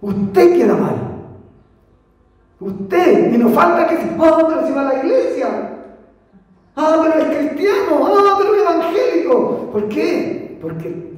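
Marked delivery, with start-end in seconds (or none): 4.2-9.7 mocking gossip. People mocking the believer.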